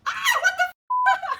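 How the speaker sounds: high, loud startled cry of "what the f"; shocked outburst. Real, not acted. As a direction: blurt it out, caught completely off guard. Shocked by a photo.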